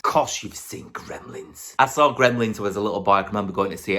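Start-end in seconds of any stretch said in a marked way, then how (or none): 0.0-1.7 slow softer whisper; slow hushed aside. Acted. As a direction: breathe it as a secret aside. Whispered aside after question.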